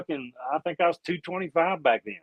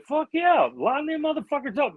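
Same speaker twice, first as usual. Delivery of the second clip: much higher, eager shout; eager yell; 11 semitones higher than usual. Retold or imitated. To the other coaches, a gung-ho high call. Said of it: "I said".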